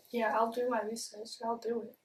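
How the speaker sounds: ordinary talk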